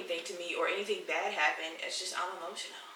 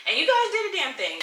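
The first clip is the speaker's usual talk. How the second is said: excited; 11 semitones higher, 9 dB louder than usual. Real, not acted. Thanking viewers for the views.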